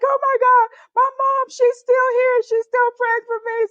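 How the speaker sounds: high, sustained mock wail; tearful, overwhelmed wail, played up; retold bawling. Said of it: "I'm just bawling like".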